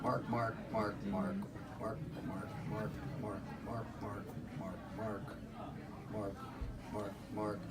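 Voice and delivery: low, soft barked "Mark" like a small dog; dog barking mark. Pretend. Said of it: "Sounds like a hair-lipped dog".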